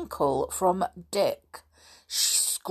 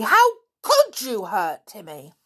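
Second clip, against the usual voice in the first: higher, louder outraged reproach; indignant; 4 semitones higher, 6 dB louder than usual. A narrator's reading. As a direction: demand it in wounded outrage. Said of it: "said George indignantly".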